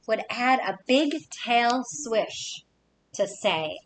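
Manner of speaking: ordinary delivery, nothing marked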